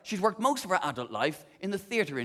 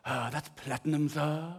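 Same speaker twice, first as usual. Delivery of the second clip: deferential salesman; 3 semitones lower, 6 dB quieter than usual. Retold or imitated. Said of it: "what he actually said was".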